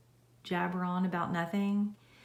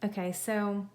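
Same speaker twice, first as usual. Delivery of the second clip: ordinary delivery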